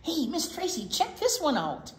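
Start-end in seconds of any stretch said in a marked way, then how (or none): none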